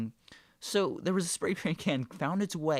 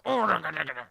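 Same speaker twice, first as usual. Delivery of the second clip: imitated angry noises; 3 semitones higher, 5 dB louder, faster than usual. Imitating the supervisor's second failed try, loud angry sputtering. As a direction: sputter like a man losing his temper. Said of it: "getting his angry noises".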